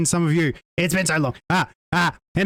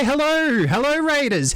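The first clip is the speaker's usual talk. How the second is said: excited greeting; 7 semitones higher than usual. Real, not acted. Streamer to arriving Raiders, excited greeting. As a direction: call out high to welcome a crowd.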